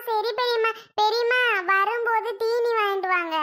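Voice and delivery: ordinary delivery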